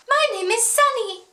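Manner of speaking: high, chirpy put-on greeter; imitated perky camp host; sunny camp woman. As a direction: introduce yourself in a high, sunny voice. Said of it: "from the sound of her voice".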